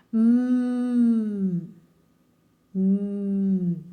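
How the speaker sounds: "M" as a long closed-lip hum; sound of M. Shown as a demonstration. Teacher isolating the letter sound, hummed "M". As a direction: hum a bare "m", twice, no vowel. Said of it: "o som do M é".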